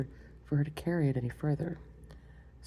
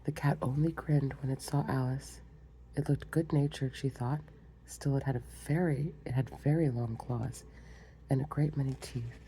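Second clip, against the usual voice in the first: usual speaking voice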